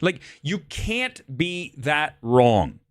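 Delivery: each word stretched slowly and pressed; slow emphatic scolding. Real, not acted. To Simone about her bad take, slow emphatic scolding. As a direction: drag it out to scold him.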